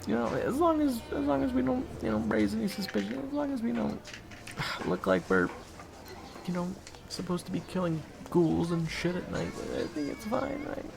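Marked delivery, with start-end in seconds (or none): none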